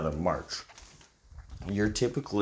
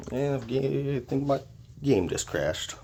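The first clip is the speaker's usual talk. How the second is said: sudden high surprised break; 9 semitones higher, slower than usual. Real, not acted. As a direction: caught off guard, voice jumps up. Game crashes mid-sentence.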